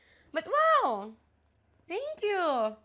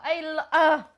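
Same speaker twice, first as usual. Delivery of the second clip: usual speaking voice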